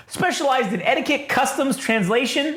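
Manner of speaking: high, prim protocol droid; drawn-out, high-pitched formal recital; C-3PO. Acted. Droid listing his specialties, high and prim. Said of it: "he talks just like C three P O".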